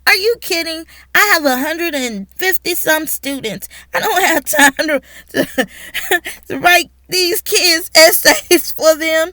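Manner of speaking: loud, high-pitched exasperation with a laugh; incredulous protest. Retold or imitated. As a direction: protest as if the accusation is absurd. Acted incredulous retort to suspicion.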